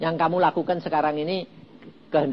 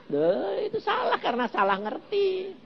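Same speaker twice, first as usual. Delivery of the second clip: ordinary delivery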